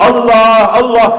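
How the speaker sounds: loud, high cry of God's name; impassioned calling out; fervent calling. Shown as a demonstration. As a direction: call out as if pleading.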